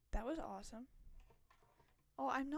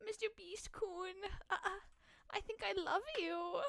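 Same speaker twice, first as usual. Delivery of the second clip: shy first i love you; 4 semitones higher, 4 dB quieter than usual. Pretend. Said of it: "I have a crush on Mister Beast".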